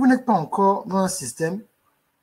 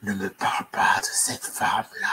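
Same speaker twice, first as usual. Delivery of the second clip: chuchoté, grave et sinistre; murmure-le comme une poupée maudite; chuchotement inquiétant; 8 semitones lower, 4 dB quieter than usual. Acted. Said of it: "Une voix bizarre". (voix bizarre chuchotée et grave)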